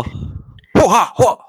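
high, slow, loud goofy chant; hammed-up hoo-ha. Friends riffing, goofy high slow hoo-ha chant. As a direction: ham it up like a cartoon. Silly put-on voices banter.

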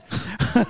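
high scornful laugh; imitated taunting chuckle; mocking laugh. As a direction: laugh him off. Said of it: "And he's laughing".

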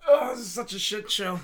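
ordinary delivery

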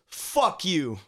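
a lightly voiced grumpy man snapping back; grumpy retort. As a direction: toss it back like a cranky stranger. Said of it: "he just goes".

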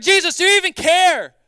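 high, loud frantic cry to Jesus; panicked yell. Acted. Disciples in the sinking boat yelling at Jesus in panic. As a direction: shout it over the storm, desperate.

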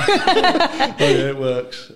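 laughing it out, high and bright with a laugh; a burst of real laughter, pitch jumping up. Real, not acted. Guest laughs while the host teases him about the gin.

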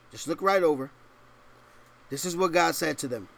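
usual speaking voice

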